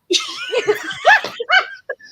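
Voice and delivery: high, loud burst of laughing; loud laughter. Real, not acted. Friends joking over slang, loud shrieking laugh. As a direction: crack up at your own impression. Laughing after the shouted impression.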